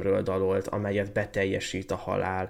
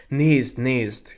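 ringing call of triumph; excited cry; 4 semitones higher, 9 dB louder than usual. A narrator's reading. Tree calls to the nightingale, crying out. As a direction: cry out, higher and much louder. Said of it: "kiáltott a fa".

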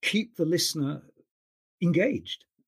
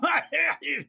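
high shouted laugh ending the French accent parody; mock shouted laugh; 13 semitones higher than usual. Shown as a demonstration. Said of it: "That's just ridiculous".

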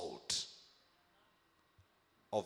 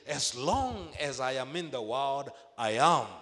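louder, higher proclamation; preaching at full force; 4 semitones higher, 9 dB louder than usual. Acted. Preacher declaring Jesus' words to the church, loud. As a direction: proclaim it to the back pew. Preacher repeats the quote, peaking.

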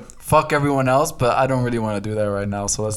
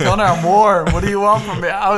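high, louder playful protest; flustered protest; 9 semitones higher, 5 dB louder than usual. Real, not acted. Co-host defends himself to laughing friend, flustered protest.

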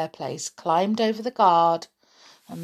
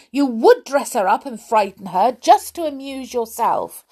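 louder, raised-pitch telling-off; angry scolding; 5 semitones higher, 5 dB louder than usual. Acted. Teddy blames the doll for frightening kitten, scolding. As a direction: scold sharply and accusingly. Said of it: "shouted Teddy".

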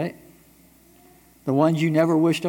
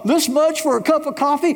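higher, indignant mock customer; mock indignant complaint; 11 semitones higher, 3 dB louder than usual. Customer complaining about coffee price, mock indignant. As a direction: sputter at the price in mock outrage. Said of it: "you're complaining".

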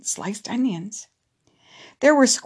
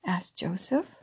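ordinary delivery, nothing marked

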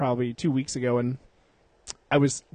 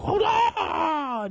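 crying out. Retold or imitated. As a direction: call out urgently before breaking bricks. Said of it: "And he's like".

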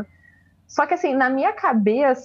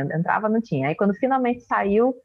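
ordinary delivery

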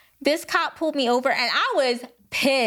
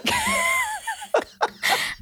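laughing shriek. Real, not acted. Disbelief at expired registration.

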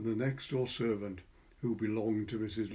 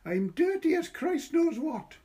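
put-on high, slow little boy; boy's voice; 4 dB louder than usual. A narrator's reading. Boy boasting to Patricia about his dirt, high and slow. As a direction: stretch it out in a high child's voice. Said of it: "the boy said".

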